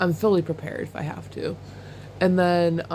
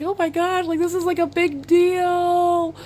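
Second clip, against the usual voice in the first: awed excitement; 10 semitones higher than usual. Retold or imitated. Retelling her awe at the show.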